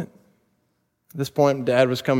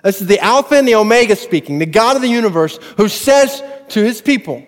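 slow, higher and louder, building; preaching at full force; 7 semitones higher, 6 dB louder than usual. Acted. Preacher voicing God to his people, climactic and fervent. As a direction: proclaim God's promise with rising fervor.

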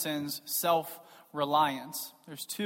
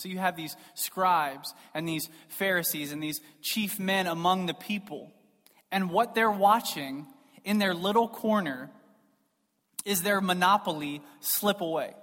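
usual speaking voice

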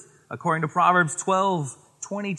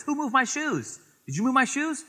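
much higher parent voice asking around; high questioning parent; 10 semitones higher than usual. Retold or imitated. Parent asking the kids who moved the shoes, high and questioning. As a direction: pitch up, an innocent-sounding parent question.

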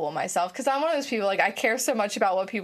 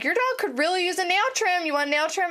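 higher, eager voice pushing a nail trim; mock pushy sales pitch; 7 semitones higher than usual. To customers at her table, an eager higher sell. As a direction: sweetly push the nail trim on them. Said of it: "I'm like".

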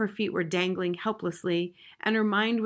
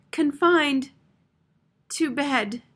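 high, plaintive little voice; small high voice with a long pause; tiny voice; 7 semitones higher, 5 dB louder, much slower than usual. A narrator's reading. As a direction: pipe it up high, in broken phrases. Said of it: "the tiny voice was lost".

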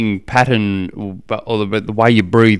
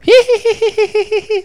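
imitated daughter's very high, drawn-out giggle; mock little-girl tittering, stirring up her brother; teasing giggle; 4 dB louder than usual. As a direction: squeal out a teasing hee-hee. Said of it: "Just my daughter stirring him up".

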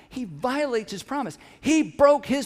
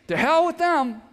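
"To hell with them" said again, pitched up, stress on "hell"; a higher, cheekier second take of "To hell with them"; repeated, higher; 6 semitones higher, 4 dB louder than usual. Shown as a demonstration. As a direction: toss off "To hell with them" with a high cheeky lift. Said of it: "That's what that means".

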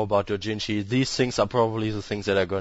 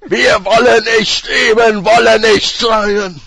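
much higher, loud, slow ranting German; mock German rant; 14 semitones higher, 14 dB louder, slower than usual. Co-host shouts in mock German to joke about accents. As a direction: bellow like a dictator's speech.

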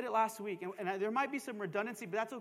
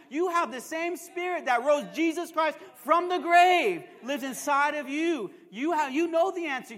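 loud, emphatic sermon voice raised in pitch; full-force preaching; 4 semitones higher, 6 dB louder than usual. Real, not acted. Preacher urging believers about the Spirit inside them, full-force preaching. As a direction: drive it home to the congregation.

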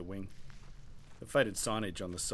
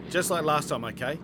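loud, higher shout; yelling over the engine; 7 semitones higher, 10 dB louder than usual. Acted. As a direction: call out over the wind. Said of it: "he yelled".

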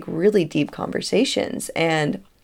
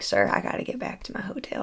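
whisper; 2 semitones higher, 9 dB quieter, faster than usual. Retold or imitated. Trying to leave the shop.

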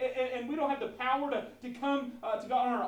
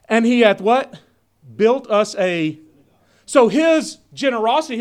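loud, driving sermon delivery; booming pulpit voice; full-force preaching; 17 dB louder than usual. Acted. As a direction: preach it out to the congregation.

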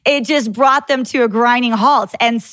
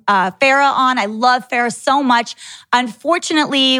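ordinary talk, nothing marked

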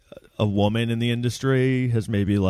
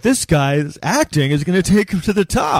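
mock impressed theatergoer; 8 semitones higher, 3 dB louder than usual. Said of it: "and be like".

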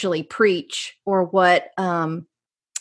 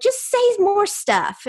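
higher, slow, coaxing mock voice of people begging her to keep talking; mimicking eager listeners; 12 semitones higher, slower than usual. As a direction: playfully imitate fans who love her accent. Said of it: "people were like".

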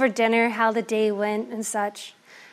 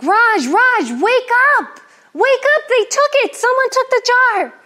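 much higher, much louder frantic screaming; panicked shriek; 12 semitones higher, 8 dB louder than usual. Acted. Wife screaming at sleeping husband that the jar is gone. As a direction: shriek to wake him, panicking.

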